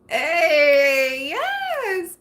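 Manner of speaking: high, delighted squeal; excited yes. Real, not acted. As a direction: squeal it with glee. Excited exclamation.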